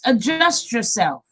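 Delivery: ordinary delivery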